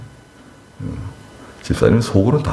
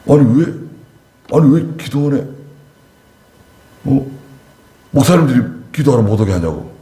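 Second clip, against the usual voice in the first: raised, indignant retelling; angry complaint; 7 semitones higher than usual. Retold or imitated. Both husband and wife complaining, high indignant mock protest. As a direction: imitate the furious couple, higher pitch. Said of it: "굉장히 화가 났더라고요".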